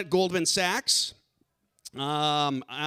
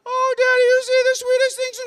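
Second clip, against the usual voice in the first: put-on very high, loud girlish falsetto; adoring daughter voice; 8 dB louder, faster than usual. Daughter flattering Daddy in a skit, gushing falsetto. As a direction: gush it high and fast to Daddy.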